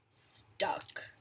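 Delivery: unvoiced whisper after a long pause; whispered payoff. A narrator's reading. Reader whispers the punchline to the kids. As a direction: drop to a hush on the last word.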